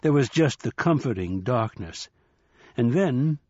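ordinary delivery

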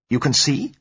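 high-pitched, urgent question; swift interruption. A narrator's reading. Doctor breaking in on the patient, swift and urgent. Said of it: "he interrupted swiftly".

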